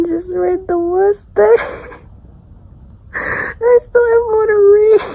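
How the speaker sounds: real tears breaking through; slowly choking up, real crying; fighting tears. Real, not acted. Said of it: "I'm not gonna cry".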